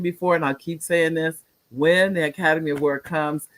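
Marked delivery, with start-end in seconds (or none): none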